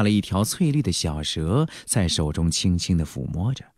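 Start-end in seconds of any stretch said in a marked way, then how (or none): none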